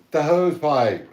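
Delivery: loud, high and slow, The hose pipe stretched out; emphatic insistence. Retold or imitated. Husband telling his California wife the word, loud slow emphatic. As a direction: insist on it like it's obvious. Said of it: "But I was like".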